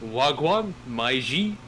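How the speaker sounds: put-on lightly done London roadman slang accent. Friend demos his favourite roadman accent, lightly. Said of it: "French roadman".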